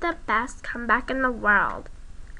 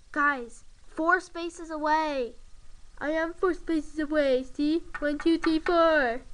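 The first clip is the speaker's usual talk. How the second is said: usual speaking voice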